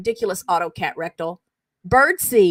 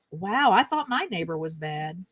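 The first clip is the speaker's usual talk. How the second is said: ordinary talk, nothing marked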